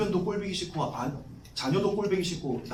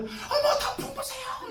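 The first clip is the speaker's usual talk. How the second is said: very high falsetto gushing; exaggerated squeal of delight; excited women passengers; slower than usual. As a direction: mimic women thrilled by the scenery. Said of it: "우리 여자 전사님들".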